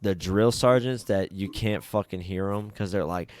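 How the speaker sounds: usual speaking voice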